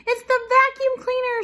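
very high, loud panicked cry; terrified storybook mouse; shrieking. A narrator's reading. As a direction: shriek the warning at full pitch. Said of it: "shrieked Melissa".

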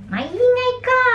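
high, annoyed snap; annoyed scolding. Retold or imitated. Translating the angry line into Tagalog, high and annoyed. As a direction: tell them off for being noisy. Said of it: "may yo yun pag galit ka".

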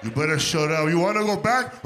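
loud stern owner scolding; loud mock threat to a dog.

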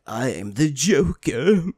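slow, drawn-out villain impression; badly faked theatrical villain voice; Joker. Player imitating Mark Hamill's villain, slow and theatrical. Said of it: "I can't do the Joker".

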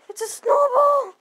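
high loud panicked cry; panicked yell. Acted. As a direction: scream as you get eaten. Being eaten again.

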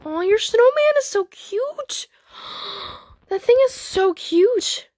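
high, drawn-out squeal of adoration; gushing over cuteness. Real, not acted. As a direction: coo it slowly, way up high. Gushing over a cute pet.

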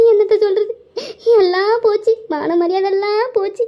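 high, hammed-up sobbing wail; crying lament. Wife mourns her lost honour to husband, high tearful wail. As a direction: wail in despair through put-on tears. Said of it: "ஒழுங்கா அழுவாத நடந்ததை சொல்லிட்டி".

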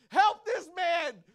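high, loud, slow shout; imitating strangers raising the alarm; calling for help.

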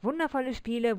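ordinary delivery, nothing marked

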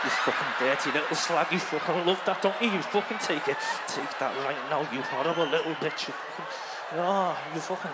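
low, growled mock dirty talk. Acted rough sex talk.

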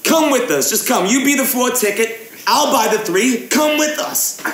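high, pleading fake English accent; put-on English accent. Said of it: "gaming in an English accent".

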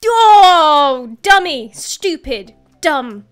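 sudden yells of dismay; panicked yelling. Real, not acted. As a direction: shout the insults in real panic. Panicked outburst at mistake.

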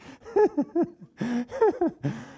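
high squealing laughs; helpless high giggling fit; real laughter. Real, not acted. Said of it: "Forgive me for laughing".

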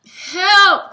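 very loud, high scream; cry for help. A narrator's reading. Uncle Ned crying out as dragonfly lands, loud. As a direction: scream it in panic. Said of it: "cried Uncle Ned".

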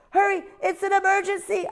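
a tiny owl pretending there's an emergency; little owl's alarm. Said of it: "Ozzie cried".

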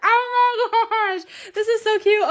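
long, drawn-out high squeal; excited squeal. Real, not acted. Creator opening a surprise gift package, excited squeal. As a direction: stretch every word out high in delight.

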